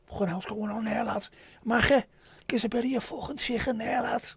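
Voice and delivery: high, hammed-up Scouse lad. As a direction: exaggerate the guttural Scouse. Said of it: "as bad as Scouse when it comes to that".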